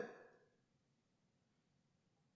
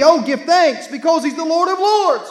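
preaching shout; 6 semitones higher, 5 dB louder than usual. Real, not acted. Preacher reading psalm with fervor.

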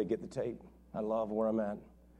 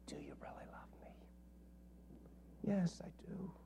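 hushed whisper; 8 semitones lower, 11 dB quieter than usual. Acted. Jesus and Peter's quiet answer, whispered. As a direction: drop to a whisper for the tender moment. Jesus asks one final time.

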